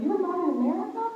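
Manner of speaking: high, fast surprised ask; quick surprised question. Retold or imitated. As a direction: fire it off in disbelief. Friend's question retold.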